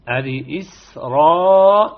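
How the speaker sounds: إسرائيل slow, the madd held two beats; short madd demo. Shown as a demonstration. Teacher models the exception word. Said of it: "نتقى اثنين الحركات".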